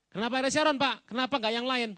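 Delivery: loud, high, disbelieving ask; indignant question. Retold or imitated. Retelling a listener asking why Sharon, high and loud. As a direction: demand to know why him. Audience question voiced loudly.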